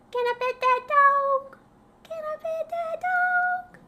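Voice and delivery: very high, loud, slow squealing plea; shrieking high-pitched question; excited squeal. Acted. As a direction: squeal it like a thrilled fan. Squealing request, repeated.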